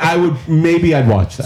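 usual speaking voice

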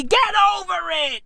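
high-pitched comic exasperation; exasperated cry. Acted. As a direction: push it way up high, fed up. Yelled punchline of the rant.

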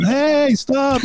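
high yelled cry to passing cars; mock scream. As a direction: shout it high to flag down cars.

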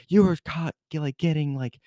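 high, mock-stern teacher scolding, quickening at the end; imitating the teacher scolding a student for leaving class. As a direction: impersonate the strict teacher telling you off. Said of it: "I got yelled at by that teacher".

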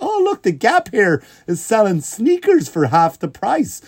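high, slow, airy clueless shopper; oblivious tourist. Retold or imitated. Comedian playing himself as a clueless tourist, high and slow. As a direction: breeze along unaware of everything. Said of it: "I'm just, like, walking, like".

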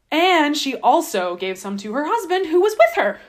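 loud, high stress on "who was with her"; emphatic verse reading. Acted. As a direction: read it out pointedly, building on "who was with her". Reads verse, punching husband's presence.

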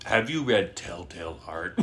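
low, self-important mastermind voice; brilliant mastermind. Acted. Mocking the movie's ultra brilliant mastermind, low and grand. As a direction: pose the question low, as a genius would. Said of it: "ultra brilliant, like, like, mastermind is like".

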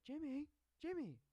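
high, loud imitated call; high-pitched mock cry for attention. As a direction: call his name as a mock Lori. Retelling Lori calling out to Jimmy.

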